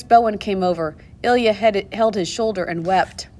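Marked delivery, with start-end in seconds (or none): none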